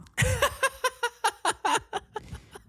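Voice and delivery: a high, long burst of laughter. Real, not acted. Remembering not understanding him, she bursts out laughing.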